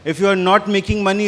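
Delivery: usual speaking voice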